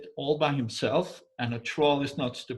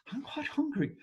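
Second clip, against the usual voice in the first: high, light and soft; girl's hungry voice; 14 semitones higher, 4 dB quieter than usual. Retold or imitated. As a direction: say it like a peckish young woman. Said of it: "she was going".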